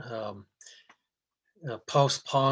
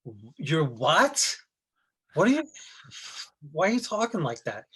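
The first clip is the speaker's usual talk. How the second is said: incredulous; 3 semitones higher, 5 dB louder than usual. Retold or imitated. Annoyed cousin questions his accent.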